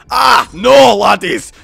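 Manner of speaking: put-on high, shouted Scottish accent; Scottish doctor. Scottish doctor interrupts his rivals, shouted Scottish accent. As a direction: burst out as an indignant Scot. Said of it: "I'm having a free accented conversation".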